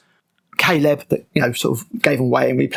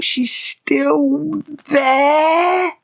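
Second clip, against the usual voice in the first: much higher, drawn-out incredulous wail; exasperated cry; 11 semitones higher, much slower than usual. Real, not acted. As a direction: stretch it out in disbelief at the plot hole. Exasperated outburst at plot hole.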